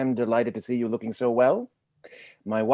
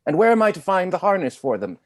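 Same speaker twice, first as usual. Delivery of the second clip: indignant outrage; 8 semitones higher, 6 dB louder than usual. Acted. Steward outraged by the lady's request, loud indignant protest. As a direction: bristle at the very idea.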